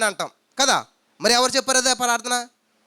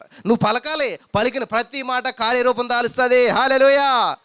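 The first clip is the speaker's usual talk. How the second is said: raised fervent preaching; preaching at full force; 4 semitones higher, 6 dB louder, slower than usual. Acted. Preacher rousing the congregation, fervent and raised. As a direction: drive the point home to the church.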